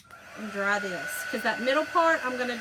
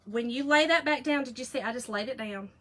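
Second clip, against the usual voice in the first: ordinary talk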